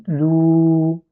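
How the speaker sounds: "Lou" with its vowel held; prolonged "Lou". Shown as a demonstration. Teacher shows the prolonged syllable. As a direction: hold the "ou". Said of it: "Et je prolonge".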